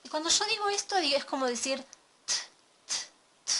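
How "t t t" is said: "t" as a faint unaspirated tap, barely voiced; a whispered, weak Spanish t; soft Spanish t. Shown as a demonstration. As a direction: tap the "t" lightly with no puff of air. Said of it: "Suena muy tenue, suena muy bajito".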